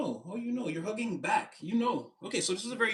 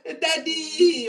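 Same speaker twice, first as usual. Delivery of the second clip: put-on high, loud child calling daddy; childlike call; 13 semitones higher, 10 dB louder than usual. Inner child calling for daddy, high childlike call. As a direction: call out like a little kid.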